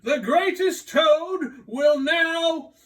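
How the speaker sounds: high, slow, shouted showman announcement; booming announcer. Acted. Strange voice announcing the dream star, booming and slow. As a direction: call it out like a circus ringmaster. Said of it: "the voice said".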